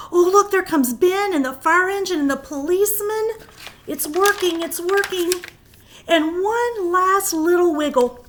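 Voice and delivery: thrilled it's working; excited storyteller. Acted. Excited as characters return.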